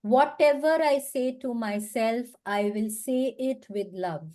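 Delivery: each word slow and deliberate, self-assured; slow affirmation. Shown as a demonstration. Coach showing the mirror affirmation, slow and deliberate. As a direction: speak it slowly, like a promise to yourself. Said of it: "बार बार बोलिए".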